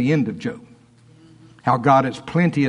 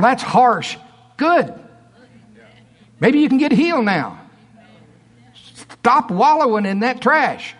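high, forceful exhortation; preach; 9 semitones higher, 4 dB louder than usual. Real, not acted. Preacher telling church to stop wallowing, full-force preaching. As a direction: drive each command home with raised pitch.

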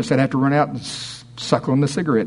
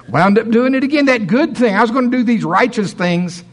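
high excited complaint; 8 semitones higher, 3 dB louder than usual. Retold or imitated. Mock frustrated struggler.

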